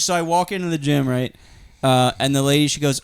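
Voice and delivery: usual speaking voice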